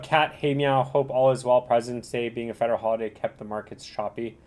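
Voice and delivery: ordinary talk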